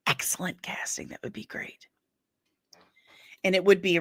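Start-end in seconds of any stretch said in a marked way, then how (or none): 0.1-1.9 hushed musing. Real, not acted. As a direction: drop to a near-whisper. Musing on a casting idea.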